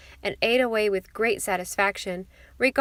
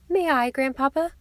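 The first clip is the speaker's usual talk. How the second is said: put-on higher-pitched hopeful child's plea; eager cry; 6 semitones higher, 4 dB louder than usual. A narrator's reading. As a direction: ask eagerly, lifting the voice. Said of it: "cried Phronsie, her red lips curling".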